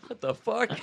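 high, baffled mock reaction; retold disbelief. Roommates finding a fake grave outside, mock disbelief. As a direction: pitch it up in mock astonishment. Retold shock at the stolen headstone.

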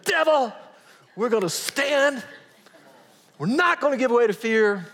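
high, loud battle cry; high, loud defiant declaration. Retold or imitated. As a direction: stand up to the devil.

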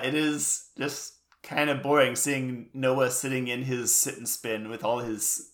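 ordinary talk

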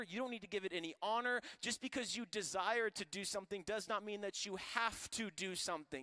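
loud, high, driving preacher voice; preaching at full force. Acted. Preacher pressing the church at full force. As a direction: preach it hard to the congregation. Preaching hard on Paul's words.

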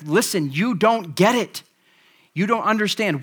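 Peter setting him straight; firm rebuke. Retold or imitated. Peter rebuking Simon, insistent and firm. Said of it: "he's like".

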